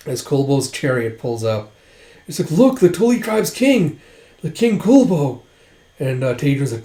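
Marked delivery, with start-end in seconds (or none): 2.5-5.4 high-pitched soldier's exclamation; retold alarmed announcement. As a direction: call it out in acted alarm.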